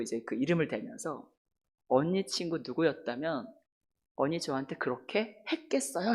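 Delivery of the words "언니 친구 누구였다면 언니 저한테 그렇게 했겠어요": softer, lower retold challenge; quiet reproach. Crying younger friend questions the speaker's fairness, quiet reproach. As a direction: put the accusing question softly.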